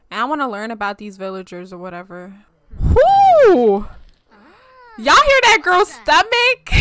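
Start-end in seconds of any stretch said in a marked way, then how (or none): 2.8-3.9 high, loud drawn-out whoo; loud whoop. Real, not acted. Reacting to a stomach noise. 5.0-6.8 high, loud, breaking into laughter; loud amused disbelief. Real, not acted. Cracking up at stomach noise.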